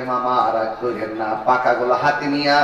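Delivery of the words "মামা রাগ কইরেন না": low, soft plea to uncle; nephew pleading. Retold or imitated. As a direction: coax him gently and low. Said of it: "ডাক দিয়ে কি".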